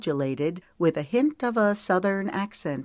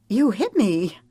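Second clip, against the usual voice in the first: loud, high indignant protest; startled and offended, call him out; indignant cry; 4 semitones higher, 5 dB louder than usual. Acted. Woman hit by his ball protests loudly.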